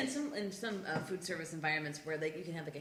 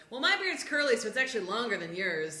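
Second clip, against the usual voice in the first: mocking roommate; 5 semitones higher, 7 dB louder than usual. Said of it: "how one of them's like".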